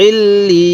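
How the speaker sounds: "ইল্লি" with doubled l and a long drawn ee; one-alif stretch. Shown as a demonstration. Teacher showing learners the alif-length pull, drawn-out joining. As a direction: hold the double l in "ইল্লি", then stretch the vowel. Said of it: "সেখানে এক আলিফ টানতে হয়".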